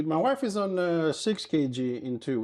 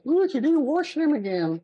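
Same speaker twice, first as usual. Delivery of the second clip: high, louder scolding nag; nagging viewer; 10 semitones higher, 4 dB louder than usual. Retold or imitated. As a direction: mimic a scolding complaint.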